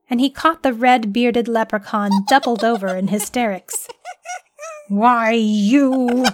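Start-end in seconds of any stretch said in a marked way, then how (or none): 4.9-6.2 louder, annoyed growl. A narrator's reading. Man tricked by a leprechaun growls in anger. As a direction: snap at the laughing leprechaun. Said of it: "he growled".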